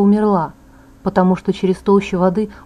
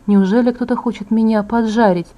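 usual speaking voice

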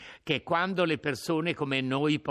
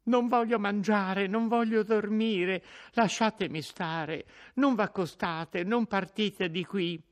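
higher, pleading refusal; distraught young woman; 10 semitones higher, 3 dB louder than usual. Acted. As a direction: beg to be left alone, on edge.

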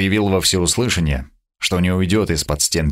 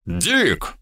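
fierce bark; 3 semitones higher, 3 dB quieter than usual. Acted. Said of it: "Бернард гаркнул люто".

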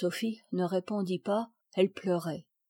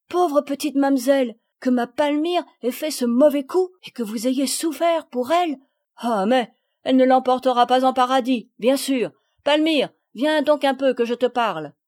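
loud, higher-pitched outrage; indignant; 4 semitones higher, 7 dB louder than usual. A narrator's reading. Gardener learns her daughter's misdeed, loudly indignant. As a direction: exclaim hotly, then summon the culprit. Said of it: "elle prit un air indigné".

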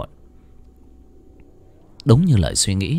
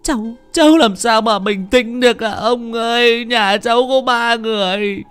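high, slow, pleading lament; grieving mother, tearful; 13 semitones higher, 3 dB louder than usual. Acted. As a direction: pour out grief to the old man.

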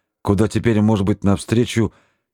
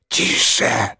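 threatening whispered hiss; menacing hiss. A narrator's reading. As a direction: hiss it as a warning. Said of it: "угрожающе зашипел Горелов".

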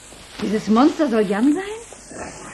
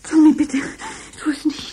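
flehend; 7 semitones higher than usual. Acted. Gloria fleht Tommy an.

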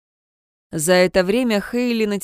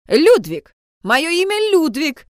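loud furious shout, higher pitch; 8 semitones higher, 6 dB louder, slower than usual. A narrator's reading. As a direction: stamp and shout the name furiously. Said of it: "яростно топнул ногой".

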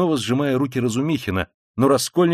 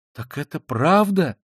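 mild, slow, higher astonished question; crying out; 6 semitones higher, slower than usual. A narrator's reading. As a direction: draw out the question in disbelief. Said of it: "вскричала Пульхерия Александровна". (удивлённо, протяжно)

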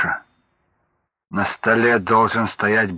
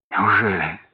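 fearful exhale; 2 semitones lower than usual. Acted. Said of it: "со страхом выдохнул он".

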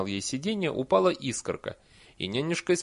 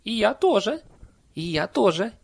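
high, loud, insistent nanny voice; pointed reminder; 10 semitones higher, 8 dB louder than usual. A narrator's reading. Nanny reminds villager she is a witch too, pointed. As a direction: press the point with each repeat. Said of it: "напомнила нянюшка Як".

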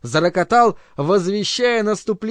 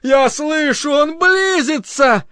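much higher, slow eager exclamation; exclaim; 9 semitones higher, 3 dB louder, slower than usual. Acted. Bernard pretends to hear, exclaiming eagerly. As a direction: fake the rapture eagerly. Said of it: "воскликнул".

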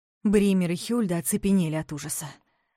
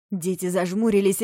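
plain reading voice

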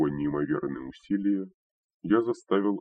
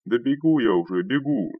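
loud, higher-pitched hurried assurance; raised voice, conceding under pressure; placating call back; 6 semitones higher, 5 dB louder than usual. A narrator's reading. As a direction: call back to appease while running off. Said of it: "примирительно отозвался удаляющийся голос первого".

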